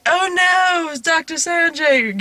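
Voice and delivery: a put-on "oh no", drawn out; mock dismay. Mocking a fake reaction.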